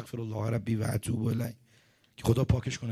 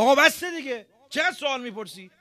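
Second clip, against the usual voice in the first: loud, high exasperated outburst; losing temper; 11 semitones higher, 8 dB louder than usual. Retold or imitated. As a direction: snap at a pestering student, voice shooting up. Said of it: "آمپر چسبون".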